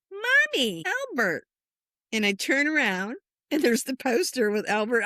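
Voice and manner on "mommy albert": put-on very high-pitched child's call; little boy. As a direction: call out to mom like an excited toddler. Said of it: "all of a sudden he goes".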